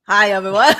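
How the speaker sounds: loud, laughing mock of a sleepy greeting; just-woke-up impression. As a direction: tease his just-woke-up hello, laughing. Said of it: "you look like you just woke up".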